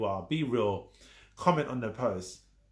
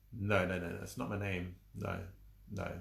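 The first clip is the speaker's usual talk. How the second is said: muttered denial; 6 semitones lower, 8 dB quieter than usual. Retold or imitated. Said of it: "you're like".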